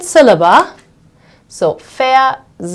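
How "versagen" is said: versagen in three pieces with pauses, ver, sa, gen; split into syllables. Shown as a demonstration. Teacher shows second syllable, versagen broken up syllable by syllable. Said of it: "when we analyze the syllables".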